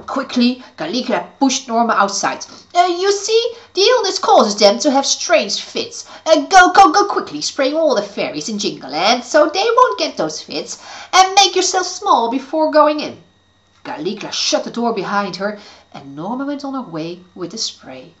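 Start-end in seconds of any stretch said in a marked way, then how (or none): 2.7-13.2 high, loud scheming witch; urgent, fake-kind witch. Witch speaking to niece.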